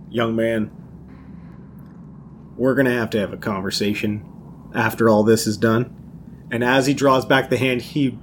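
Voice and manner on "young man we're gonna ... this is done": low, deliberate address with a long pause; stern elder Thaddeus. Acted. Thaddeus to Gutterbird, hand on shoulder, low and deliberate in character. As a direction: lower your voice, weighing each phrase. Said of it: "Gutterbird's shoulder and say".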